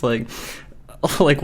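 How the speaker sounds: ordinary delivery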